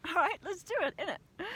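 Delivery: hammed-up English geezer accent, innit; broad English. Climber hyping herself up in a mock broad English accent. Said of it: "I'm getting more English".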